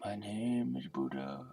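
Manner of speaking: slow, soft, solemn Buddha; a hint of a meditation guru introducing himself; mock Buddha voice. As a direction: intone it like a serene guru. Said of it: "let's do a Buddha meditation instead".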